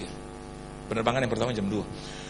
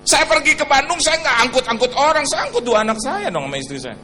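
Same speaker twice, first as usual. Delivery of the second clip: louder preaching, rising; lively preaching; 8 semitones higher, 6 dB louder than usual. Acted. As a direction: carry the crowd along. Preaching at full force. (khotbah bersemangat)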